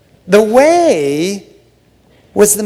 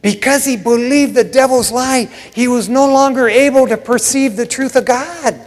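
emphatic repeat; 6 semitones higher than usual. Shown as a demonstration. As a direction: drive the point home. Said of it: "Did you hear what I said?".

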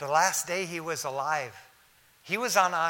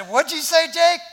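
raised, high-pitched shout to be heard; loud calling out; 14 semitones higher, 12 dB louder than usual. Retold or imitated. Son-in-law asking his dying relative to repeat, shouting high. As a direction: call it out loudly at a patient. Calling to patient he can't understand.

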